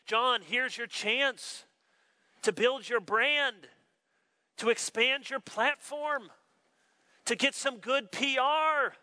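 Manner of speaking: high, slow, drawn-out urging; coaxing adviser. Acted. An adviser coaxing John to build his brand, coaxing. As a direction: sell each phrase, lifting the pitch and pausing between. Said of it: "if we were whispering in his ear, someone would say".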